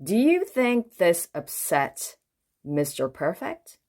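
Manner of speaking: ordinary delivery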